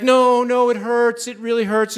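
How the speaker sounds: whimpering protest, lightly acted; tearful complaint. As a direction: imitate a woman starting to cry in pain. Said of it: "she starts to cry a little bit".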